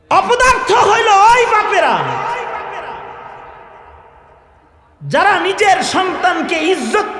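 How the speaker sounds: high, impassioned sermon cry; fiery preaching. Real, not acted. Preacher condemning fathers who seek worldly honour, fiery preaching. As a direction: denounce them to the whole crowd. Denounces fathers at full force.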